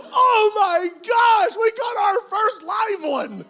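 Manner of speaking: high, loud and slow with joy; elated shout. Retold or imitated. Pastor reliving his joy over the first convert. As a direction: shout it like you just won.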